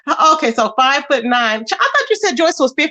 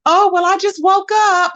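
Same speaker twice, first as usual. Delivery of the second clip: lightly mocking, higher-pitched impression; hint of a put-on voice, pitched up; imitating a frail woman; 8 semitones higher than usual. Mocking a woman she'd beat in a fight, lightly imitated. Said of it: "she's always like".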